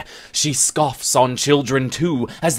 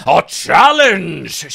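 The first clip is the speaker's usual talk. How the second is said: loud, higher, slow declaration; crying out; 6 semitones higher, 8 dB louder, slower than usual. Acted. Scot challenging the shy Bennet: loud slow cry. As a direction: throw down the challenge loudly. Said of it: "she cries".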